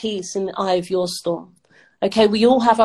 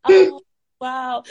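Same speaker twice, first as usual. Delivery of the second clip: moved to tears; 3 semitones higher, 6 dB louder than usual. Real, not acted. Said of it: "You're gonna make me cry".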